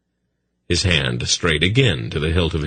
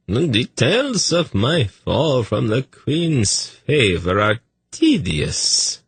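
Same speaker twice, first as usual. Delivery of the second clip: much higher, slow and airy; lightly voiced nervous eunuch; 8 semitones higher, slower than usual. A narrator's reading. Tzadi continues.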